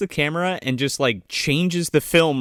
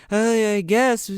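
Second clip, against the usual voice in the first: higher, lightly put-on woman's voice; imitated woman; 7 semitones higher, slower than usual. Co-host playing the crash victim answering, higher mock voice. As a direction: answer as her, pitched up.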